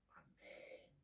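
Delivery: breathy hushed amen; a private, reverent whisper. Real, not acted. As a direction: breathe the amen almost silently.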